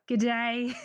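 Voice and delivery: mock Aussie g'day. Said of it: "I'm Australian".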